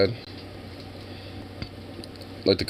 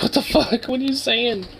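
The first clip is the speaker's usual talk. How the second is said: high, incredulous mock disbelief; shocked reaction. Streamer reacts in disbelief to a TV comment, pitched high. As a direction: squeak out the disbelief. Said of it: "I was just like".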